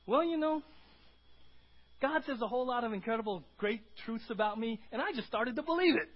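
bright, high, loud joyful reply; answering the neighbor with loud joy. Acted. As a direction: bubble over with joy. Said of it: "And your neighbor says".

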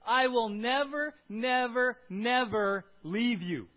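each "never" loud, high and drawn out; slow, loud weight on "never, never, never"; emphatic triple never. Shown as a demonstration. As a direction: hammer every "never" slowly and separately. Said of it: "That's the emphasis in the original language".

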